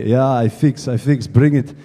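low mock Russian accent; Russian-accented mechanic. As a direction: imitate a Russian mechanic grudgingly agreeing. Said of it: "He's got a big Russian voice".

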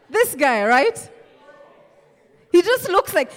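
usual speaking voice

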